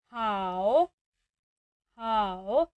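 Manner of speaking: हाओ low, dipping and full; third tone. Shown as a demonstration. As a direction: model हाओ with a deep dip. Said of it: "इस पर आ रही है third tone". (तीसरी tone में हाओ)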